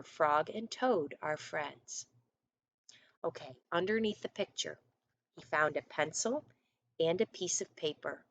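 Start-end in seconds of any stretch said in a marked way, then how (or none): none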